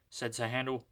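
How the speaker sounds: plain reading voice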